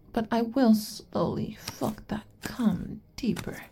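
soft whispered promise. Acted. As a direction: drop to a whisper. Whispered line in roleplay.

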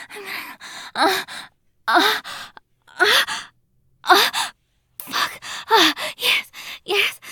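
rising high loud moans; climax moans. Acted. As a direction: build the moans to a peak. Building to climax.

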